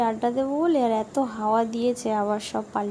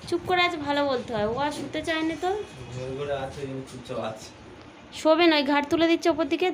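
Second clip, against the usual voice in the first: excited chatter; 3 semitones higher, 4 dB louder than usual. Real, not acted. Talking about the fussing baby.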